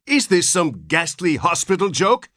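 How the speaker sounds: high, raging outburst; father rages at the nurse, pitched high; enraged. A narrator's reading. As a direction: spit out the accusation in rage. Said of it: "his terror resolving into rage".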